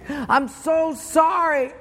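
slow, high-pitched pleading; emphatic mock apology. Driver apologises again.

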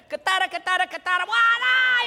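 hammed-up fiery wife rattling off nonsense Spanish; mock angry shout in mock Spanish. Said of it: "she speaks full Spanish".

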